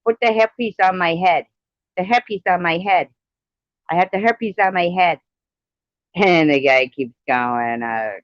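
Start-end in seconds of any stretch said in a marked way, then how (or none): 0.1-5.2 lightly voiced Indian man, pitched higher; lightly acted Indian character, raised pitch; Indian guy. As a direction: mimic an Indian man a little, insistent repetition. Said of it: "this Indian guy".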